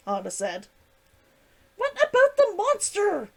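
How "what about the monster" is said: sharp high growl. Acted. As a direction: snap it out loud and tense. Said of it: "Hisashi growled".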